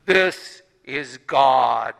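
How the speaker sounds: God calmly instructs the dangling hiker; God's voice. Acted. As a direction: speak slowly like God from the heavens. God's voice answers from above.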